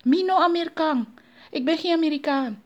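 high, loud, frantic protest; panicked confession. A narrator's reading. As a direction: plead that it was all pretence. Frightened Anansi confesses disguise.

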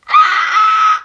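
a sudden high, loud scream of fright; shocked scream. A narrator's reading. The princess screams at her loose tooth, loud and high. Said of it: "schreeuwde de prinses".

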